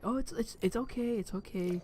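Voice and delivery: high, soft soothing imitation; mock reassurance. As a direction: imitate a comforting office lady, softly. Office lady reassures embarrassed child.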